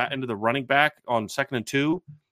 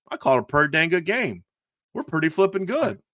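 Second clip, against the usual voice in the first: mock Kentucky accent; 6 semitones higher than usual. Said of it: "was that your Kentucky accent".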